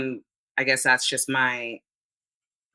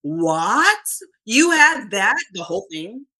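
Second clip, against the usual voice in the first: rising what, what, then a loud, much higher you had that; mock disbelief; 5 semitones higher, 4 dB louder, much slower than usual. Reacting to friend's cheat photo, higher, louder disbelief. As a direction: act stunned at the friend's cheat meal. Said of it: "and I'm like".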